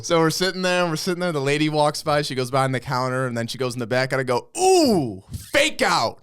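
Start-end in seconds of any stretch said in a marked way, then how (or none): 4.6-6.2 high, loud whoop of false hope; mock-excited heckle. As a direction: call it out loudly, playing to the line. Teasing cry when clerk walks past.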